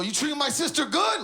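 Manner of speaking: high, confident challenge; loud confidence. Pretend. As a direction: confront him boldly, pitch climbing. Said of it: "You know that confidence?".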